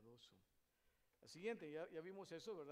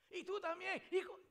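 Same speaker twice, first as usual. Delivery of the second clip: very high, loud cut-off cursing; hammed-up furious shriek; angry yelling; 17 dB louder than usual. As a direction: scream insults in an acted quarrel. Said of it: "qué hacemos cuando estamos enojados".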